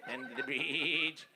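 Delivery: mock Kennedy Boston drawl, slow at the end; lightly hammed Kennedy accent. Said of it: "only in the Kennedy family".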